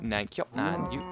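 ordinary talk, nothing marked

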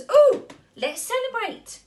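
high, loud ooh of joy; delighted. Acted. Sugar Plum Fairy clapping to start the party. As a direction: squeal it with glee.

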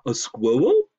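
loud, lisping moose question; congested moose. A narrator's reading. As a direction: ask it loudly, r softened to w. Moose asks in put-on voice.

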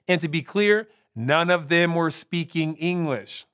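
ordinary delivery

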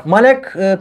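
ordinary delivery

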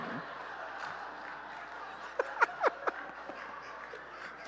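high airy silent laugh; wheezing laughter; breathless laugh. Real, not acted. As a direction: lose it laughing without voice. Laughing at sicko family line.

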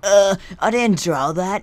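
slow, put-on nervous friend; imitating Kamari. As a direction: drawl out the excuse as Kamari. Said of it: "He's like".

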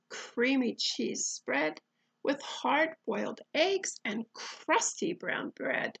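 ordinary delivery

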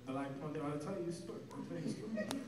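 low, very soft Persian dad murmur; dad's Persian accent. Retold or imitated. Dad telling son how he met mother, low soft accent. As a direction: hush it down like a tired Persian father. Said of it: "he'd be like".

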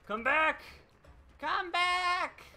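put-on high, wailing cry of a crazy old mountain hermit. Killer calling after fleeing survivors in hermit voice. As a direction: yell it like a half-mad hermit on a mountain. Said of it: "crazy old mountain hermit in the Creeper episode".

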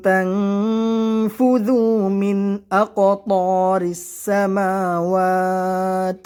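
slow, melodic tajweed chant with long held vowels; Quran recitation. Acted. Teacher reciting the verse to his students in a slow melodic chant. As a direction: chant each word out slowly like a qari.

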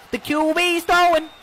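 rising fast play-by-play call; excited sports commentator. Acted. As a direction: call the touchdown.

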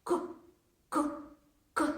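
«к» breathed out, short and voiceless. Shown as a demonstration. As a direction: exhale each «к». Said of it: "Ми ніби видихаємо цей звук". (видихає звук «к»)

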